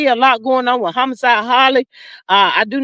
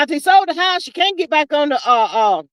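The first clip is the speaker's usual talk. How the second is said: ordinary talk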